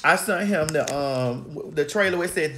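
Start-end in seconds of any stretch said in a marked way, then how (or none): none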